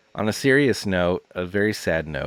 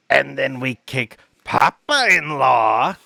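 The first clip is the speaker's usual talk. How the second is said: high, loud, slow squawking comedian voice; Gilbert Gottfried impression; 6 semitones higher, 5 dB louder, slower than usual. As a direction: blare it out, stretching each word. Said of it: "like, a Gilbert Gottfried impression".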